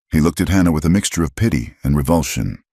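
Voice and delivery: plain reading voice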